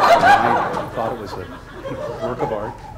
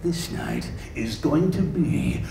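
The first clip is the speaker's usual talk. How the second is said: slow, low, soft announcer seduction; movie trailer narrator wooing his wife; romantic trailer voice; 3 semitones lower, 6 dB quieter, slower than usual. Acted. Trailer guy getting romantic with his wife, slow and deep. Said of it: "trying to get romantic with his wife".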